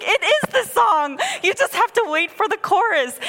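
high, loud exasperated retort; exasperated mom. Retold or imitated. Mom re-enacting arguing over the song with her toddler, exasperated. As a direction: re-enact arguing back at a whining child.

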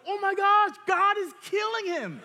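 high, loud panicked shout; mock panic. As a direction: cry out like the leaders feared the worst. Youth leaders panicking at scene.

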